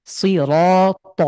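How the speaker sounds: সিরাত drawn out very slowly with a full, heavy র; slow demo of সিরাত with a heavy র; thick র. Shown as a demonstration. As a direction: stretch সিরাত, making the র thick. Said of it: "এটা মোটা".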